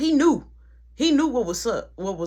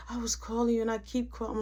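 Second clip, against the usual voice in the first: hushed urgent whisper; 9 dB quieter than usual. Retold or imitated. Coworker whispering in store.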